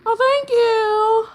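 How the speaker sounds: high, slow, loud thanks; delighted thanks. Real, not acted. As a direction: sing out thanks to a new sub. Thanking a subscriber.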